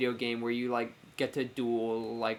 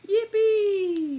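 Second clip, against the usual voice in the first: high, loud joyful squeal; excited cheer; 8 dB louder than usual. Real, not acted. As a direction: squeal with delight. Cheering a tier request granted.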